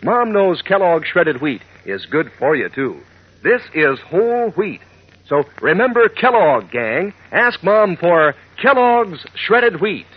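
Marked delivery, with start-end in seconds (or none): 3.4-10.1 ad read. Acted. Ad closing pitch.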